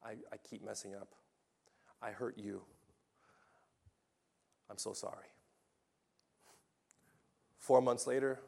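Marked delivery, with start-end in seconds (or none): 0.0-1.2 fast, ashamed whisper; husband confesses to wife in a hushed whisper; whispered confession. Retold or imitated. 2.0-5.3 remorseful husband. Retold or imitated. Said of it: "Second husband says".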